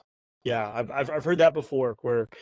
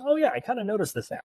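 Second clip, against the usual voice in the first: lightly acted client, higher and quicker; high, quick imitation of someone just noticing; client's casual realization; 9 semitones higher, faster than usual. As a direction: toss it off as a sudden, offhand noticing. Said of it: "or are they going".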